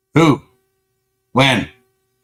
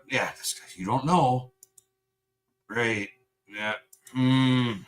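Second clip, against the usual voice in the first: normal talking voice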